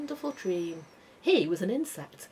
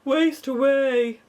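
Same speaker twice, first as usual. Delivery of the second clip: high, loud, slow moan; slow moaning earthworm; 8 semitones higher, 9 dB louder, slower than usual. A narrator's reading. Earthworm lamenting starvation to the crew, slow loud moan. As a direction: drag the moan out in despair. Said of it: "moaned the earthworm".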